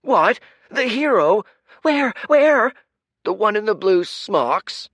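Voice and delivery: very high, loud eager calls; excited crowd voice. Acted. Crowd member hunting for the hero, very high and loud. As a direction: crane your neck and shout for the hero.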